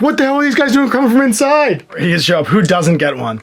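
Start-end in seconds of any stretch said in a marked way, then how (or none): 0.0-1.9 high, lightly voiced confused guard; baffled guard. Imagining the exit guards seeing heroes come out, mock-baffled. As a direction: play a guard caught off guard, pitched up. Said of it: "these two guards at the exit being like".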